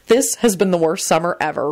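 ordinary delivery

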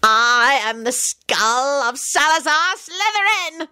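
high, slow, grandiose villain cry; triumphant scream. A narrator's reading. Scully announces himself to his foes, screaming triumphantly. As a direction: proclaim it high and drawn out. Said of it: "Scully screamed out triumphantly".

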